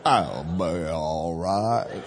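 slow, low southern drawl with a laugh. Retold or imitated. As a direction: try the southern guy again, lazily. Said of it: "Can't even do it in a northern accent".

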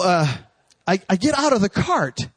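ordinary delivery

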